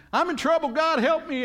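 high, loud, desperate cry for help; mock panicked plea. Pastor voicing a believer in trouble, panicked high plea. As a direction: play the frightened believer begging God. Believer begging God in crisis.

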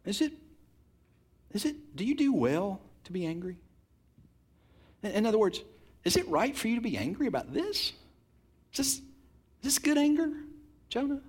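God gently questioning angry Jonah. Retold or imitated. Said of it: "God very gently says".

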